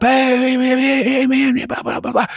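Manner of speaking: put-on high, chattering kids' voices; high kid chatter, hey hey blah blah; crowd of kids. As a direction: mimic a swarm of pestering kids. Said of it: "they're all like".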